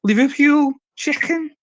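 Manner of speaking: Scouse accent. Shown as a demonstration. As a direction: put on a Scouse accent, slow. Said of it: "Have you heard of my Scouse accent".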